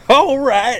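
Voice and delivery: high, loud mock English echo; mock English accent. Interviewer echoes the guest's English accent, laughing.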